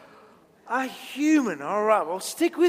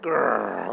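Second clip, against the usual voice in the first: long, very low growl; angry growl; 14 semitones lower than usual. Acted. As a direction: growl deep like a cross crowd.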